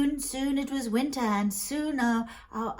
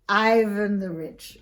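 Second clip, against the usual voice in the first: usual speaking voice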